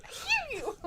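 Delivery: high, drawn puppet catchphrase; terrorist puppet. Acted. Repeating the puppet's catchphrase for laughs, high mock threat. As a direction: squeal the threat as a comic puppet. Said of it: "his terrorist puppet".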